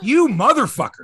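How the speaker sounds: hammed-up outrage at the manager; angry curse. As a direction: spit it out like an insulted slugger. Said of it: "Like, he's pissed".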